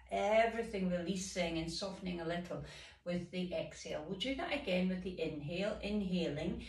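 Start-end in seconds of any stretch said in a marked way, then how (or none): none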